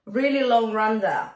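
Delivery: ordinary talk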